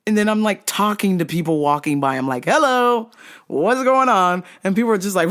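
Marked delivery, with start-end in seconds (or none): 2.4-4.4 breezy bright hello; casual cheerful greeting. Retold or imitated. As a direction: greet passersby as if nothing's wrong. Casual greeting while choked.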